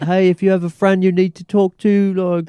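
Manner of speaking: high, loud imitation of a British fighter's accent; hammed-up British accent impression; Patty Pimblett accent. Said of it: "you do good accents".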